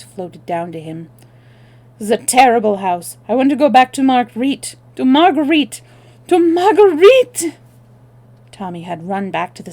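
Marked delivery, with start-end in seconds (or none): 2.0-7.6 building high, loud plea; louder still. Acted. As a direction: cry out more each time. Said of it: "louder still".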